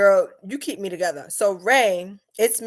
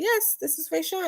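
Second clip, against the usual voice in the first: much higher polite confirmation; insistent phone voice; 12 semitones higher, 3 dB quieter than usual. Retold or imitated. Confirming her name to a confused caller, high insistent phone voice.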